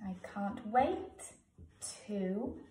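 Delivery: drawn out slowly as if writing; slow dictation. Shown as a demonstration. Teacher writing the letter aloud for pupils, slow. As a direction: say it slowly while you write. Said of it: "teacher writing aloud".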